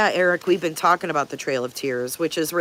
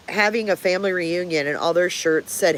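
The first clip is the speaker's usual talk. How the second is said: ordinary talk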